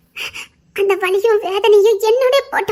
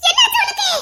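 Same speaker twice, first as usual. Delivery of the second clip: very high, tearful shout; panicked, tearful question; alarmed cry; 12 semitones higher than usual. Acted. Friend finds her distressed.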